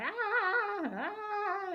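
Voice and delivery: high, put-on yelping "Ah, ah"; cartoon yelps. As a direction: squeal like panicked cartoon critters. Timon and Pumbaa running through birds.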